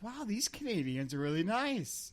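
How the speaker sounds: high, swooping wonder; acted amazement. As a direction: marvel as if pleasantly astonished. Acting out his naive amazement.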